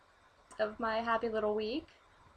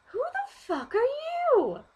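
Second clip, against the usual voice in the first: much higher, disbelieving voice; incredulous; 5 dB louder than usual. Retold or imitated. To a stranger who said smile, incredulous. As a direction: react in shocked disbelief. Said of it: "And I'm like".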